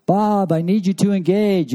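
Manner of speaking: high, slow, earnest movie-wife imitation; Incredibles movie quote. Speaker quotes The Incredibles to the men: high slow imitation. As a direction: mimic the cartoon wife pleading with her husband. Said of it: "Anybody seen The Incredibles?".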